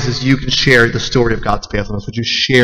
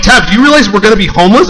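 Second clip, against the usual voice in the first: fast, higher, loud alarm; panicked; 8 semitones higher, 5 dB louder, faster than usual. Retold or imitated. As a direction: rush it in mock panic. Panics about losing house.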